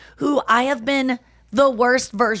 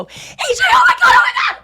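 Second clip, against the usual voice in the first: mom's panicked scream; 7 dB louder, much faster than usual. Retold or imitated. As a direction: scream in panic, very high and loud. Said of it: "I hear my mom go".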